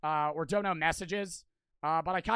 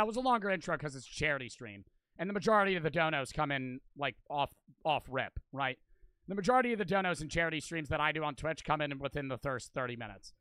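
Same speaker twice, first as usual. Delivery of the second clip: ordinary delivery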